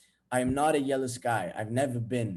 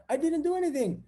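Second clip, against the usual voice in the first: much higher-pitched mock protest; defensive girlfriend; 12 semitones higher than usual. Girlfriend to jealous boyfriend, high defensive protest. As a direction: voice her innocent objection.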